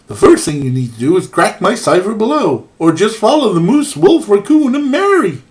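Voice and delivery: put-on overdone Canadian accent, rising to the end; bad Canadian accent. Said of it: "I'm trying to do a very bad Canadian accent".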